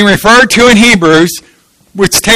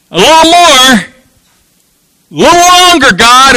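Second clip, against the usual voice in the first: high, stretched-out appeal to God; frustrated plea; 5 semitones higher than usual. Acted. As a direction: plead upward, exasperated. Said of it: "He gets kind of frustrated".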